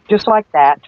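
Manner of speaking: ordinary talk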